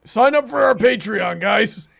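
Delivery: loud, high hammed-up sales pitch; cheesy ad-read voice; mock plug shout. Mock shill to viewers.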